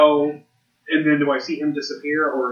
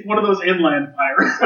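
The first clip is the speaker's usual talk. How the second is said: laughing through the words; amused laughing; 3 semitones higher than usual. Real, not acted. Player joking about pirates in wheat country, laughing. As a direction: toss out the joke while laughing.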